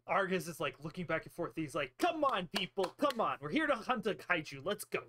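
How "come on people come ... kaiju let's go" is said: acted call to the group, louder at the start; rally them, starting high and punchy; impatient rallying. Argus urging the party on to the hunt, impatient rallying.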